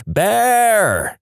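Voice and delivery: high sing-song mock scream, drawn out. Buddies waking a napper in camp, sing-song scream. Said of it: "my buddies sing-songily screaming".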